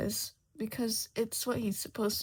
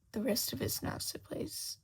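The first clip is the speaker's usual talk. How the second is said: ordinary delivery, nothing marked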